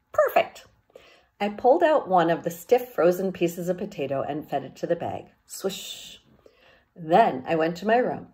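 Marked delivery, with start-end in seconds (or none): 0.1-0.6 perfect. Acted. Narrator voices a character's satisfied remark. 5.4-6.2 whispered swish sound, soft. A narrator's reading. Sound of frozen potato in the bag.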